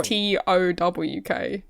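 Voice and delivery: T O W K spelt slowly, the cohost's own vowel; spelling T O W K. Shown as a demonstration. Cohost spelling how he says talk himself. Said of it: "I, I say".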